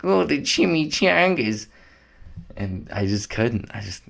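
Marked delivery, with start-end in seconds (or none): 0.0-1.7 high, slow silly cartoon voice; goofy comedy voice. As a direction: ham up a dumb movie joke. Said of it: "like, making a joke".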